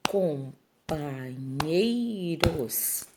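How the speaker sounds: com-pa-nhei-ros said very slowly, each syllable apart like a clap; "companheiros" beaten out syllable by syllable; split into syllables. Shown as a demonstration. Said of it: "vocês podem bater palmas". ("companheiros" separado em sílabas, bem devagar)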